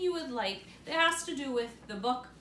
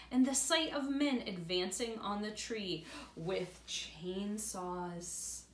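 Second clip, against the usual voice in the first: put-on reading aloud to children; story narration. A narrator's reading. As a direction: tell it warmly to little ones.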